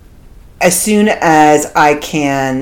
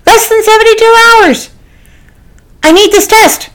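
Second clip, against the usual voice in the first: pleading, climbing higher with each line; beg, getting more frantic as you go; desperate pleading; 10 semitones higher, slower than usual. Retold or imitated. Pleading with clinic worker for test.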